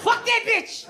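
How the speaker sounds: high, laughing cackle of outrage; laughing outburst. Real, not acted. Reacting to the British comic's jokes, a high laughing outburst.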